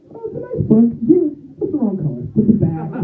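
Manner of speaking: high sweet granny voice; a high old lady, sweetly; a hint of a sweet granny; imitating grandma. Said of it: "takes a book off the shelf".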